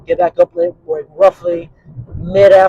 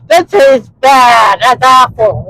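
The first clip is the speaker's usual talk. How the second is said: higher, louder mock yell of disgust, very slow at first; a kid spitting out something nasty; 7 semitones higher, 8 dB louder, much slower than usual. Kid reacting to bad wine.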